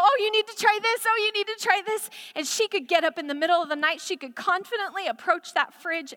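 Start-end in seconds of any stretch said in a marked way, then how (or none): 0.0-1.0 higher, louder, urging her to taste; eager coaxing. Retold or imitated. Coaxing Carmen to eat more. 1.1-2.1 higher, coaxing repeat. Retold or imitated.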